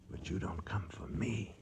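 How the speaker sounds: breathy whispered taunt, voice dropped away; bitter whisper. Acted. Hunter whispering to the stalker he cannot see, a bitter whisper. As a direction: hiss it into the dark, barely sounding.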